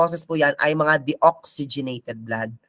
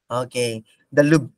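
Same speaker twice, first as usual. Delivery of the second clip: normal talking voice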